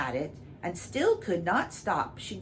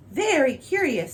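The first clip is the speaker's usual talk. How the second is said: high, loud courtier echo; 9 semitones higher, 7 dB louder than usual. Acted. Courtiers echoing the king, high and loud. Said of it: "said the courtiers".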